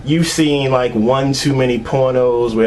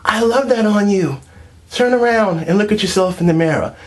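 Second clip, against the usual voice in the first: high, gushing girlfriend impression; mock girlfriend voice; 9 semitones higher than usual. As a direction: coo it up high like a doting girlfriend.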